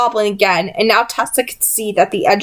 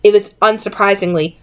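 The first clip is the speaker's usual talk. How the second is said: ordinary delivery, nothing marked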